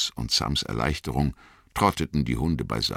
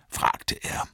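ordinary talk

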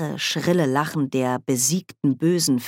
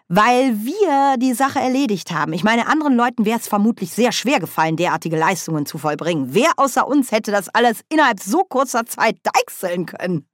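higher, animated boasting voice; Nanny Ogg, selbstzufrieden; 6 semitones higher, 3 dB louder than usual. A narrator's reading. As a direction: brag proudly about the job done. (hoch und lebhaft prahlend)

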